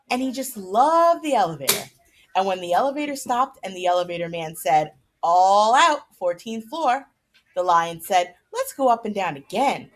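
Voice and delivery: lively storybook narration; reading to children. A narrator's reading. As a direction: read the chapter aloud to the kids with animated character lines. Reads chapter aloud to kids.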